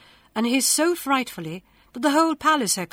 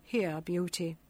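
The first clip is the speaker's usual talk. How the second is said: tearful; 4 semitones lower, 7 dB quieter than usual. A narrator's reading. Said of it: "he burst into tears".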